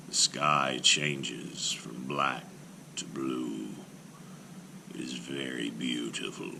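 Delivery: slow, low, soft giant in wonder; a simple giant marveling at dawn. Acted. Golem marvels at sunrise.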